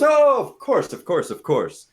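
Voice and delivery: very high, loud, eager agreement; effusive bartender. Acted. Bartender agrees to the elf's whispered order, loud and eager. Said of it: "And he goes".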